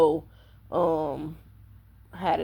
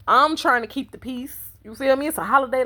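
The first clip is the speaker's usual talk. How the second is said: higher-pitched inner plea; exasperated reasoning; 6 semitones higher than usual. Retold or imitated. Telling herself to keep the holiday peace, exasperated.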